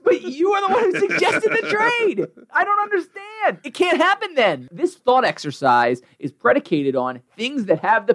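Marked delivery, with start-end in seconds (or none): 0.0-4.7 much higher sarcastic put-on voice; mock him in a high, exasperated voice. Mocking Bill's logic in a high, sarcastic voice.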